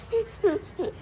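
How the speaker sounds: short high-pitched giggle; a quick squeaky laugh. Real, not acted. Laughs after gossip time.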